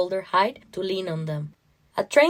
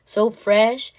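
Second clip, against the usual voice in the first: plain reading voice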